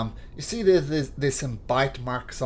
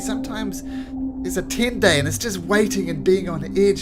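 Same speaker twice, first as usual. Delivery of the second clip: higher, strained frustrated voice; weary soldier; 8 semitones higher than usual. Acted. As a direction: vent the exhaustion, stammering at first. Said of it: "He says".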